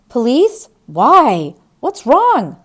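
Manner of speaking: loud, rising, high alarm; shocked the police are coming; alarmed questions. Acted. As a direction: son startled by the doctor, loud and high.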